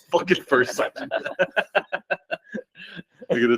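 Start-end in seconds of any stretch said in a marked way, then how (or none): 0.0-1.9 laughing. Real, not acted. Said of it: "you can't let a first sergeant get killed".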